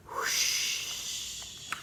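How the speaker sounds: whoosh. Acted. Rush of cold air.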